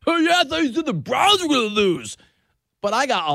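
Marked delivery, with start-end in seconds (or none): 0.1-2.2 high, loud jeering impression of an angry fan; put-on whiny heckle, pitched way up; mock heckler. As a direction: imitate a fan chirping at you over a bad take. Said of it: "people chirping at me".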